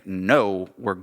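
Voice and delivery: normal talking voice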